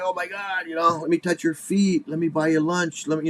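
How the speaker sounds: high, hammed-up fan adoration; mock starstruck gushing. Devotee offering to touch the famous kid's feet, hammed-up gushing. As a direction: fawn over him like a celebrity, pitched high.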